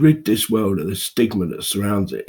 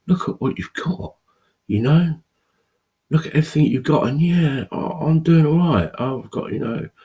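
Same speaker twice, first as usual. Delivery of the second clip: imitating a dismissive outsider, higher pitched; mocking outsider; 8 semitones higher, 4 dB louder than usual. Mimicking outsiders who don't understand him, in a higher mock voice. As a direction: put on the brushing-off voice of someone who doesn't get it, higher. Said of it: "anyone on the outside ... is gonna say".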